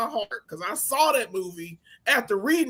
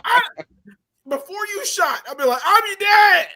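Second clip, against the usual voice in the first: mock yell at dad; 10 semitones higher, 7 dB louder than usual. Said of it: "And I'm like".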